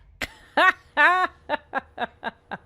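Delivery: loud, high burst of laughter; a high laugh that bursts out and trails on. Real, not acted. Laughs at the game's dialogue.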